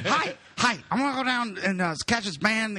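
high-pitched mock party-guy voice; Matt. Friend announcing he is going out, high hammed-up impression. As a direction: squeal it like an over-eager buddy. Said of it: "and Matt's like".

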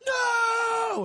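very high, sustained mock scream; freaked-out scream. Freaking-out girl answers the man, high imitated scream. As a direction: imitate her wailing one long no. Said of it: "she starts screaming and freaking the fuck out".

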